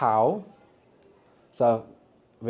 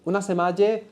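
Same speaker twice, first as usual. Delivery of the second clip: higher, rising questioning tone; puzzled "come again?"; 7 semitones higher than usual. Acted. Teacher acting out not understanding somebody, puzzled rising question. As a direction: ask it as if you didn't catch that. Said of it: "So when you don't understand somebody".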